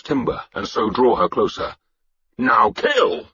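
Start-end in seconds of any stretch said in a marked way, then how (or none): 2.4-3.3 high battle-cry yell; war leader's high-pitched charge cry; shouted. Acted. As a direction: shout the attack order. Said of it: "I shouted".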